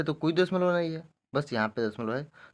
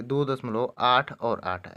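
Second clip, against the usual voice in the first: slow, separate "आठ" and "आठ"; digit by digit; 2 semitones lower, much slower than usual. Shown as a demonstration. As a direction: read "आठ और आठ" as single digits, slowly. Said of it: "एक एक करके पढ़ते हैं".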